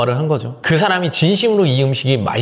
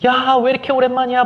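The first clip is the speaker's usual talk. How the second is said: put-on much higher gushing squeal of a girlfriend; women fussing; 10 semitones higher than usual. Women friends reuniting, gushing higher. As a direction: squeal it like a friend overjoyed to meet. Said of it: "호들갑을 떤다고".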